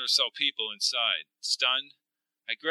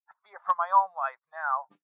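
high, frightened radio voice; scared man on a weak line; fearful; 6 semitones higher than usual. Acted. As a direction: confess fear, pitched up and tense. Mark fears for his life.

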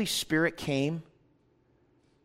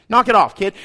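dad telling off; 4 semitones higher, 9 dB louder than usual. Retold or imitated. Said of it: "He's a dad. He's like".

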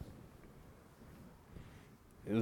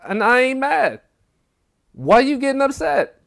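high, loud imitation of arguing; heated retort; 13 semitones higher, 7 dB louder than usual. Mondo arguing back as he is kicked out, heated. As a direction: replay the quarrel with voice pushed way up.

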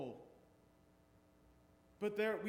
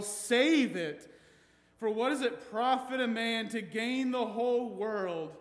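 preaching; 5 semitones higher, 9 dB louder than usual. Acted. Preaching scripture at full force.

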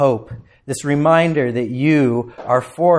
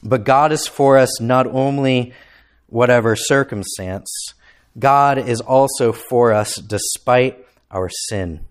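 usual speaking voice

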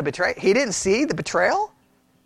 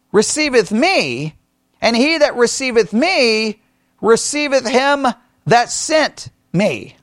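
solemn scripture reading; 3 semitones higher, slower than usual. Acted. Reading the verse aloud.

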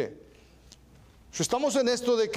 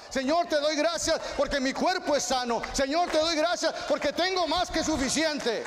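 higher, fervent prayer voice of a pastor father; imitating my praying father; 7 semitones higher than usual. Preacher mimics his father thanking the Lord in prayer, higher voice. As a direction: pray like dad does, lifting each thanks higher. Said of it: "Estoy imitando a mi papá".

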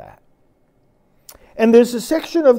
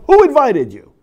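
indignant retort; 8 semitones higher, 8 dB louder than usual. Pretend. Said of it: "the Lord is saying to them".

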